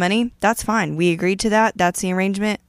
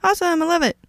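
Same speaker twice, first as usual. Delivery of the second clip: fake gift enthusiasm; 5 semitones higher than usual. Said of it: "'Cause I'm like".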